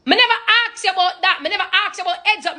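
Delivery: fast, high Jamaican patois retort; a Jamaican mom brushing off blame; defensive Jamaican mom. Retold or imitated. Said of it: "you did tell the mommy".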